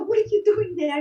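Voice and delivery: put-on high, astonished question to the kid; startled question. Clown asking the stowaway kid, high surprised. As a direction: ask it as if you can't believe your eyes.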